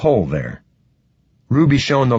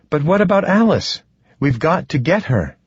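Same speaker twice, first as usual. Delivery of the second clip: high-pitched, urgent appeal; worried urging; 7 semitones higher than usual. A narrator's reading. Ruby urging Stoney to fetch Alice, worried. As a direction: push him with rising concern.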